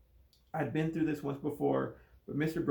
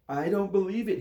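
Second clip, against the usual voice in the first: gasped; 6 semitones higher, 6 dB louder than usual. A narrator's reading. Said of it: "he gasped".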